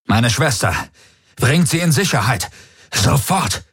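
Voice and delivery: high, urgent order rising in alarm; urgent command. A narrator's reading. Ordering guards to get his sister to safety, urgent command.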